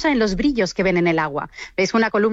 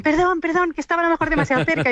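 higher, hurried apology, acted out lightly; retold startled apology; 6 semitones higher than usual. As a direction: imitate a flustered sorry after a scare. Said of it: "el susto que nos llevamos las dos". (disculpa apurada y aguda, imitada)

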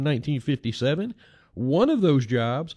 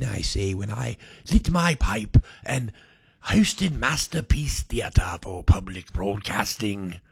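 lightly put-on TV host voice, lower; a hint of a stuffy broadcaster, lower and unhurried; half-hearted highbrow presenter voice, lower; bad Masterpiece Theater host impression; 8 semitones lower than usual. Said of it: "that's not a bad impression".

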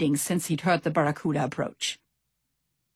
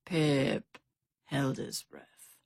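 usual speaking voice

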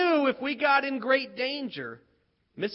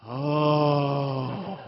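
awed oh; 8 semitones lower than usual. Retold or imitated. Kids awed as teacher transforms.

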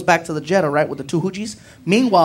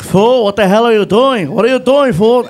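imitated yelling friend; 3 semitones higher, 6 dB louder than usual. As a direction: yell at the driver like an alarmed friend. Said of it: "Felipe is yelling at me".

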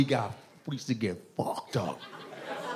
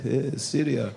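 put-on accented Syria; 3 semitones lower, 4 dB quieter, slower than usual. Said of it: "He's like".

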